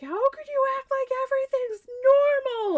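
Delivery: high, indignant retold wail; mad. Wife to gaming husband and friend, high mad accusation. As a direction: accuse them in hammed-up outrage. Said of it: "I got so mad".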